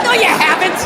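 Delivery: normal talking voice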